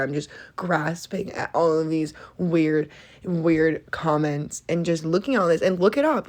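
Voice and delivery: normal talking voice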